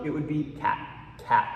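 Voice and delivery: "cat" with the final T held back; unreleased T. Shown as a demonstration. Teacher showing fast-speech "cat" to students. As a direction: stop the tongue and swallow the T. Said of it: "but in the jungle".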